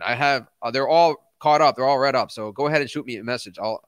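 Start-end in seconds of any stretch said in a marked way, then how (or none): none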